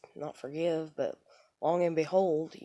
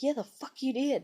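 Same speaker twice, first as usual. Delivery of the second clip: higher-pitched imitation of his comeback; retold argumentative retort; 8 semitones higher than usual. Woman voices husband contradicting her, argumentative. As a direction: mimic him insisting, without shouting.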